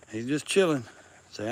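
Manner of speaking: normal talking voice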